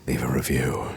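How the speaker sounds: low, soft seductive voice; sultry narrator. Pretend. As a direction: purr it low into the mic. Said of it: "sultry voices in your ear like this".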